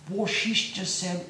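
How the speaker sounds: ordinary delivery, nothing marked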